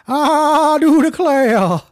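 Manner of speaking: high, slow fire-and-brimstone preacher; drawn-out hellfire preacher; Southern preacher. Pretend. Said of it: "what do preachers sound?".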